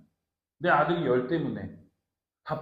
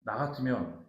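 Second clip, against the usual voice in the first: ordinary delivery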